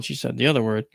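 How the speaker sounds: usual speaking voice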